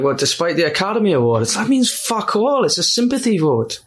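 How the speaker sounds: acted film line, high on the swearing; Trainspotting quote. Replaying Trainspotting exchange for co-hosts, high acted delivery. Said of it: "It goes, uh".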